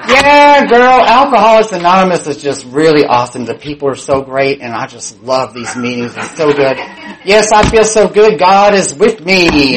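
hammed-up enthusiasm over the phone; fake gushing. Drinker on the couch lying to friends about AA, fake gushing.

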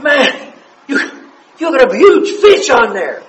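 high, loud shout about a fish; yelling to a buddy at the back of the boat; excited shout. Retold or imitated. Yelling to his fishing buddy on the boat, excited. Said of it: "I'm back there going".

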